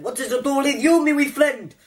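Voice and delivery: high, indignant mock Scottish; badly faked Scottish accent. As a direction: answer back as a squeaky comic Scotsman. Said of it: "You can't even do a bloody Scottish accent".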